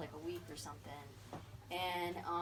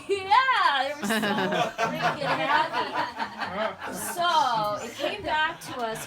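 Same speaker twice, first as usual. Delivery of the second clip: much louder, slow words through real laughter; laughing; 5 semitones higher, 13 dB louder, slower than usual. Real, not acted. Telling friends about the happy snowmobilers, laughing hard.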